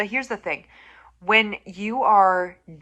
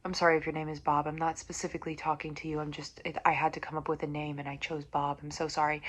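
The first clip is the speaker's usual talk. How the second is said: much softer, quick apologetic aside; quiet aside; 4 semitones lower, 9 dB quieter, faster than usual. Real, not acted. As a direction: duck the voice and rush the apology.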